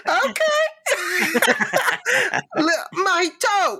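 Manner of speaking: high squeaky parody voice, laughing; high mock character voice. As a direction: play a goofy character, very high. Acting out movie parody.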